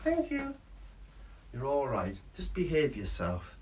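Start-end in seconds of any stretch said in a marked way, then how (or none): 0.0-0.5 a lightly high bird voice; lightly voiced bird puppet. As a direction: chirp it like a puppet.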